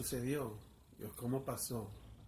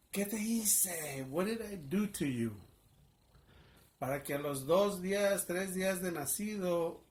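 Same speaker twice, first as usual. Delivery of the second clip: high, loud, accusing cry; retold anguished yell at God; 4 semitones higher, 4 dB louder than usual. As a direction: hurl the question at heaven. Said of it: "I began to yell out".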